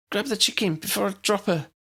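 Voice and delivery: strained warning under her breath; urgent hiss. A narrator's reading. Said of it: "she hissed".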